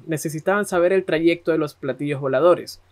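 ordinary delivery

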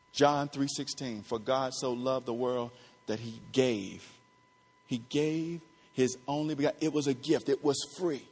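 low, very soft scripture recital; hushed preaching. Real, not acted. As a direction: drop right down and let the verse land softly.

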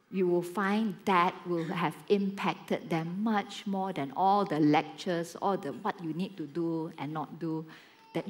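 low, soft, measured exhortation; earnest preaching. Real, not acted. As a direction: bring the sermon down to a quiet appeal. Preaches the lesson.

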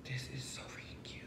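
soft breathy whisper of delight. Real, not acted. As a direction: whisper it to yourself, thrilled. Whispered first take.

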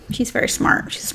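unvoiced aside whisper. Real, not acted. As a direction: whisper it like a secret. Aside about Jasmine.

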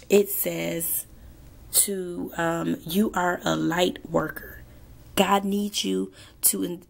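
ordinary talk

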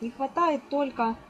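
normal talking voice

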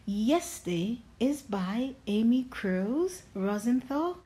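usual speaking voice

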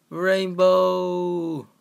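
loud high drawn-out cheer; excited call. Real, not acted. Player spotting the rainbow, loud excited call. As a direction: shout it out with glee. Celebrating in-game.

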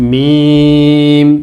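মিম with a very long, level vowel; হরফের টান. Shown as a demonstration. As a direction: stretch মিম right out, steady pitch. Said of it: "এটা হচ্ছে". (মিম অনেক লম্বা করে টেনে)

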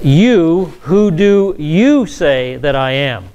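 drawn-out, weighty stress on both "You" and "you"; emphatic YOU. Shown as a demonstration. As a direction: hit "You" hard up front, then slow the question. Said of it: "The Greek has an emphatic position".

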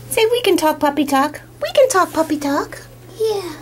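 puppy talk. Real, not acted. Owner talking puppy talk to the litter, high cooing. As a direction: chat to the pups like babies.

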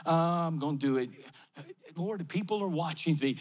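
low, self-conscious grumble to himself; imagined doubter, pitched low; reluctant doubter. Acted. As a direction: voice a puzzled man talking himself into it. Voicing the doubtful man's private thoughts.

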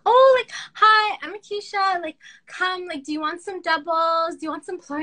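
hammed-up sweet voice offering food; imitating Akisha. To me, mimicking a friend offering doubles, high and sweet. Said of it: "She's like".

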